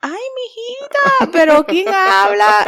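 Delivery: high, loud, mocking chatter; mock shrill caller. Imitating jealous phone call.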